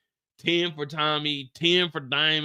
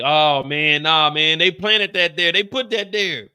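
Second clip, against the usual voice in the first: louder exasperated denial; retold protest; 7 dB louder than usual. Speaker retelling a TV scene, loud disbelief. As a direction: protest it was planted, loud. Retold outburst over the setup.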